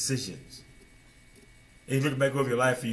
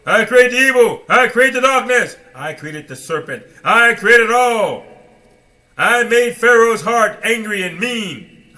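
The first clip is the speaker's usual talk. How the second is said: much louder, higher, slow booming decree; thundering proclamation; 9 semitones higher, 13 dB louder, slower than usual. Acted. The Father declaring his power, booming slow and loud. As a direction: roar each line like God himself.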